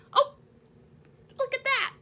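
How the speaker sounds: ordinary delivery, nothing marked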